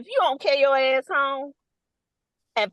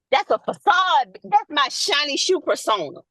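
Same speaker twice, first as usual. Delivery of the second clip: usual speaking voice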